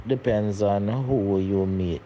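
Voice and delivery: ordinary delivery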